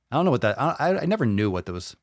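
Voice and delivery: ordinary talk, nothing marked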